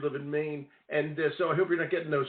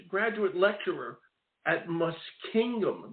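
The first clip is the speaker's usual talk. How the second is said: ordinary talk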